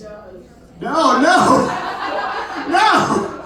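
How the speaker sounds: laughing protest shout. Real, not acted. Protesting audience reply.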